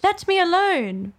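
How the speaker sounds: high-pitched indignant protest. A narrator's reading. Alice to the attacking pigeon, indignant. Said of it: "said Alice indignantly".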